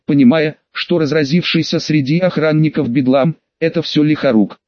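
ordinary delivery

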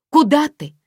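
high, loud cry; calling after someone. A narrator's reading. Lida calls after departing Aglaya, shouted. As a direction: call out sharply after her. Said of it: "крикнула ей вслед Лида".

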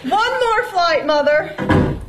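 high voice calling down stairs. Acted. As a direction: shout encouragement down the stairs. Calls down the stairwell.

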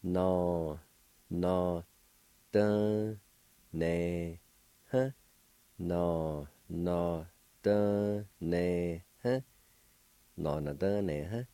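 No, no, dun, ne, huh said syllable by syllable; careful pronunciation. Shown as a demonstration. Teacher sounding out doing for learners, syllable by syllable. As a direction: break it into slow, separate syllables. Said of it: "It's pronounced like this".

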